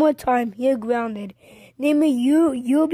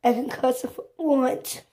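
ordinary delivery

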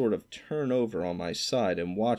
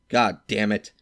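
louder exasperated curse; sore curse; 6 dB louder than usual. A narrator's reading. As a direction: spit it out, fed up. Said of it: "He was sore as hell".

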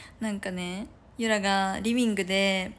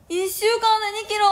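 high loud yelp at two kilos in one week; shocked outcry; 11 semitones higher, 7 dB louder than usual. Real, not acted. Realizing the diet goal, shocked high yelp. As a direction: cry out in disbelief, pitched way up.

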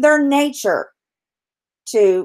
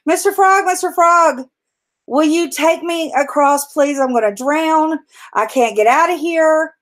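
higher, pleading little voice for the scorpion; higher-voiced storybook plea; 6 semitones higher than usual. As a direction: beg like a scorpion who is afraid to drown. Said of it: "the scorpion calls out to him and says".

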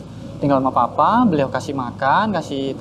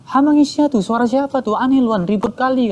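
high-pitched, grumbling complaint as papa; irritated father; 9 semitones higher than usual. Retold or imitated. Papa asking who is making that racket, irritated. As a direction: imitate a father annoyed by noise.

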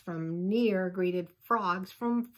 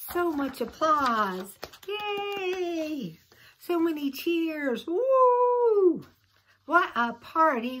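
higher and louder, cheering with the crowd; excited cheering; 9 semitones higher, 7 dB louder, slower than usual. Acted. Reader cheering for Froggy's show, excited and loud. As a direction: whoop like an audience going wild.

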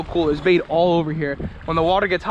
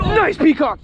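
excited cheer; 11 semitones higher, 4 dB louder, slower than usual. Real, not acted. Fish landed, cheering.

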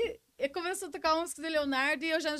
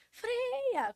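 mock shout, much higher pitch; high imitated yell of a friend; retold shout; 12 semitones higher than usual. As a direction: imitate the friend yelling brake. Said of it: "o meu amigo gritando assim".

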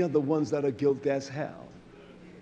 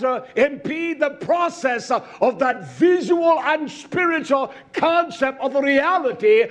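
raised, ringing sermon voice; forceful preaching; 8 semitones higher, 6 dB louder than usual. Real, not acted. Preacher proclaiming that nothing dulls the vision, forceful preaching. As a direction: drive each phrase home at a high, insistent pitch.

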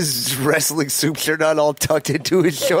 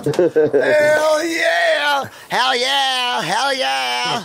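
much higher, drawn-out cheer; hyped-up whooping; 8 semitones higher, much slower than usual. Real, not acted. Cheering on the show's promo, hyped-up whooping. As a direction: holler it like a hype man.

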